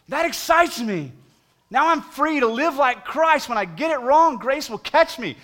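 high, loud, exultant sermon delivery; impassioned preaching. Acted. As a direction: rouse the congregation with joy. Preaching at full force.

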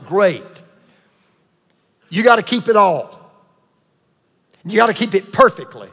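normal talking voice

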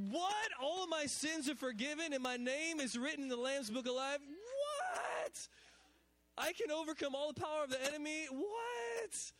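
soaring high shouted 'What?'; ecstatic disbelief. Retold or imitated. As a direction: burst out in joyful amazement.

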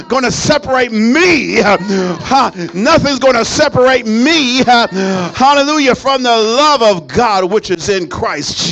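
loud, rhythmic whooping sermon; fiery preaching. Acted. Pastor preaching to the congregation, loud and rising. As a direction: preach it at full force to the church. Preaching builds again after verse.

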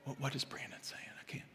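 hushed, dropping to a whisper; talking very quietly. Shown as a demonstration. Preacher making the congregation tune in, talking very quietly. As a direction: make listeners tune in to catch it. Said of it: "if I'm talking like this".

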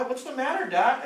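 put-on worried kids. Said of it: "my kids were saying".

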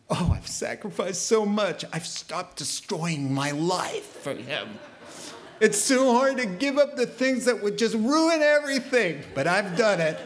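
hammed-up sigh of suffering; mock self-pity. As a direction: play a martyr complaining about sacrifice. Mocking a complaining believer.

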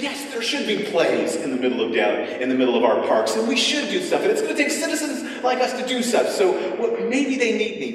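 building eager, rising enthusiasm; civic pride. Retold or imitated. Visitor inwardly deciding to help the troupe, growing eager. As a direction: swell with civic pride as you go. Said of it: "You think".

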